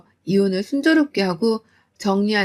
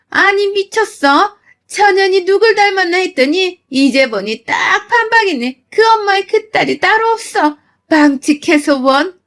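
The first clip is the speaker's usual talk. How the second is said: much higher, loud indignant shriek; shrill outrage; 8 semitones higher, 5 dB louder than usual. Retold or imitated. Mother-in-law shrieking back at the bride's mother, outraged.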